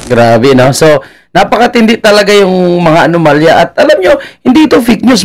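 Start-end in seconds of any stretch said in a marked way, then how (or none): 3.6-5.2 laughing high as he speaks; mocking chuckle through the words. Real, not acted. Commentator laughs while telling viewers it is not fake news.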